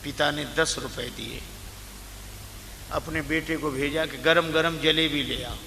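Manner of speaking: ordinary talk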